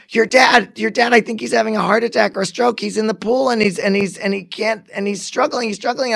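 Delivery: high, panicky retelling with stumbling repeats; freaking out. Retold or imitated. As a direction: imitate a girlfriend panicking on the phone. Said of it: "and she's freaking out".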